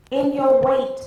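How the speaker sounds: high, loud sermon call; preacher's refrain. Real, not acted. Preacher urging listeners to remember their assignment, emphatic preaching. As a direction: punch it out to the congregation.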